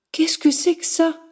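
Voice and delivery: startled question. A narrator's reading. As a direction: turn back, startled. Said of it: "fit-elle en se retournant".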